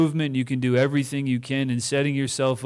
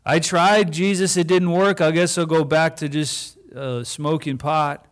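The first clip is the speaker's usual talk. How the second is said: higher, louder mock whine; whiny quitter; 4 semitones higher, 3 dB louder than usual. Pastor mocking someone going back to pot, whiny. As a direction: shrug it off like a quitter. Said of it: "Or we could say".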